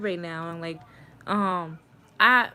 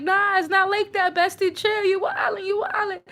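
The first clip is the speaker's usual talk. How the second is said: high-pitched imitation of a friend objecting; gently mock a friend telling you to chill; retold protest; 10 semitones higher than usual. Retold friend fending off advance.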